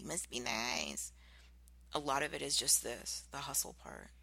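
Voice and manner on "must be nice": snide. Retold or imitated. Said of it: "rather meanly or snidely".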